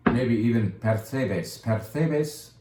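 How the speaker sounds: percebes with a lisped th sound, pair-teh-theh-bes. Shown as a demonstration. Wine shop host showing customers how to say percebes, with a lisp. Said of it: "say it with a lisp to sound cool".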